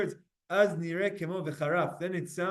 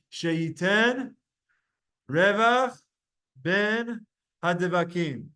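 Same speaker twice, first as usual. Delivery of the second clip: word by word, Revach louder; leading group recitation; 3 dB louder, slower than usual. Shown as a demonstration. Teacher leading the class to recite together. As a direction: call it out for the class to repeat. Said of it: "say this together with me".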